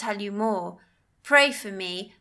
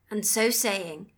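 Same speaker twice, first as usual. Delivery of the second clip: ordinary delivery, nothing marked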